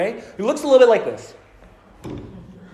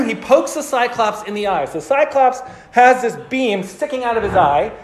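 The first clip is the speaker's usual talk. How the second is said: ordinary talk, nothing marked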